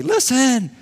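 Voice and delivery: high, loud preacher's call; impassioned exhorting voice, high and punchy; James calling out. Acted. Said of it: "James would say".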